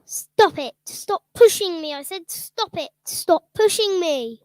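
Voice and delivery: indignant stepmother. Acted. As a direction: protest while being shoved out the door. Stepmother being pushed out.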